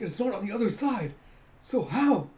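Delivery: high, loud bewilderment; baffled onlooker. Retold or imitated. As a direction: act stunned by a magic trick. Baffled onlookers of bottle trick.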